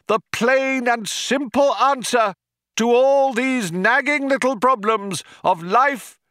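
high, ringing orator's voice; sustained grand speech to a crowd, pitched high; crying out. A narrator's reading. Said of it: "cried the man".

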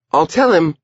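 put-on high child character voice; Edie. A narrator's reading. Girl promises her brother it is soon, high voice. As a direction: pitch it up like a little girl.

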